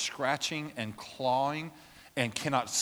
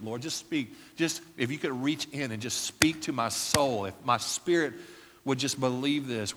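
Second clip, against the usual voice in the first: prayer. Acted.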